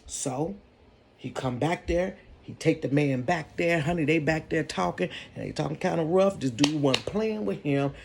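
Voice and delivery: ordinary talk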